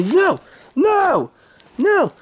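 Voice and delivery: very high, desperate cries; panicked refusal. Acted. Man told he is coming along, panicked. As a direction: squeal each refusal as if being hauled off. Acted struggle, being taken away.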